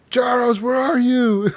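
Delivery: put-on high, drawn-out drunken call; wasted drunk. Drunk player searching the bar for a friend, mock drunken holler. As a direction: imitate a drunk calling out for a lost friend. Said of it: "I'm fucking wasted".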